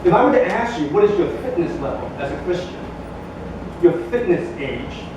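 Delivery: ordinary talk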